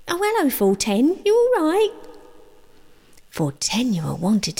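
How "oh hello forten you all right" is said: very high, chirpy friendly voice; sudden sweet greeting. Acted. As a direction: switch instantly to a bright high hello.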